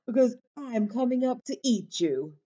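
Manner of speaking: lightly roared troll threat; roaring troll. A narrator's reading. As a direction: threaten as a hungry storybook troll. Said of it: "roared the troll".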